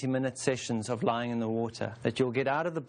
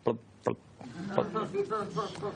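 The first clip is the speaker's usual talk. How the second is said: blub blub, the last one higher and softer; bubbling noises; 7 semitones higher, 3 dB quieter, much slower than usual. Retold or imitated. Teacher describing a rotten bathtub, playful bubbling sounds. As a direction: mimic slime bubbling in an old bath. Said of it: "It's kind of bubbling".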